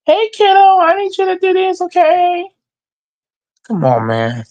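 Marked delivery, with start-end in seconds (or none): 0.0-2.5 put-on high babying voice; mock coach talking to a kid. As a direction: talk to grown men like a toddler. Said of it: "like he coaching his kids".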